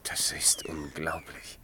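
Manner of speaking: slow, soft, breathless whisper of disbelief; stunned whisper. Acted. Berthold hears of the Indian killings, stunned whisper. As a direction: let the shock take your voice away.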